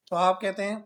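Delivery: usual speaking voice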